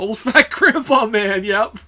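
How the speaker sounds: slowly laughing through the words. Real, not acted. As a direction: giggle out the insult slowly.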